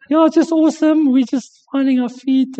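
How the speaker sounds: high, fake-cheerful mock enthusiasm with a laugh; sarcastic husband. Husband mocking his wife's cheery lockdown story, high. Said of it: "he goes".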